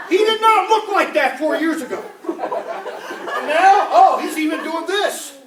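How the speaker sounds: high, loud, laughing rant; laughing mock outrage. Comedian laughing at Bernie's looks, loud and high. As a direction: crack up while ranting about his looks.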